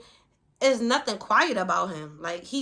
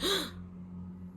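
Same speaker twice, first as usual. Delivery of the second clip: imitated gasp of surprise; sharp intake of breath, mimicking shock; 8 semitones higher, 9 dB quieter than usual. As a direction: act out a stunned gasp. Retold son hears twins news.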